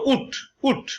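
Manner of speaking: উঠ said with a clipped short u; short u sound. Shown as a demonstration. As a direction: keep the u in উঠ short and quick. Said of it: "তুমি যখন ছোট্ট উচ্চারণ করবে".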